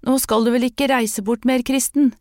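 put-on boy calling after Kristen down the road; calling after him. A narrator's reading. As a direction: call out to him as he walks off.

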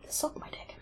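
very soft, voiceless whisper; whispered aside. Real, not acted. Muttered aside after mocked warnings.

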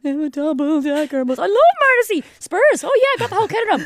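put-on eager crush-chasing girl. Younger self faking interest for a boy, high eager gush. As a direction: squeal it out to win him over. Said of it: "morph into the kind of person".

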